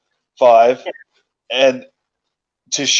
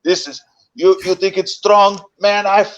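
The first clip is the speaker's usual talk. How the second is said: imitating George's taunt; 7 semitones higher than usual. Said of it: "you hear George".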